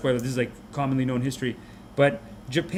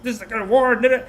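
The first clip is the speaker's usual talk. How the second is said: mock military yell; 8 semitones higher, 5 dB louder, faster than usual. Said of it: "talking and yelling and shouting".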